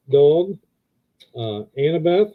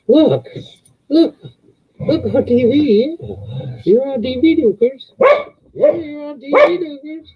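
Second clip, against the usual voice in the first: much higher, sing-song cooing at the dog; 12 semitones higher than usual. Real, not acted. Owner coos at dog on TV.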